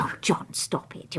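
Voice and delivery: whispered, playful brush-off; wife shushing her husband's flattery; teasing hush. Acted. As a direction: wave him off under her breath.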